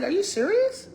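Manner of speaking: high, loud incredulous squeak; a squealed "are you serious"; mock disbelief. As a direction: act shocked at the news. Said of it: "I'm like".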